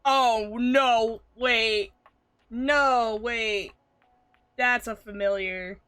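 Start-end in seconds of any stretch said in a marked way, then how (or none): 0.0-3.7 loud, urgent disbelief; raised voice, stopping oneself short; stunned realisation. Real, not acted. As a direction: burst out as it suddenly dawns. Sudden recognition of the actor.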